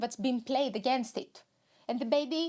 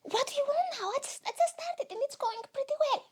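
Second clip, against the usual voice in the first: high-pitched annoyed protest; exasperated high self; 11 semitones higher than usual. Acted. Interrupted host complaining to the inner voice, high exasperation.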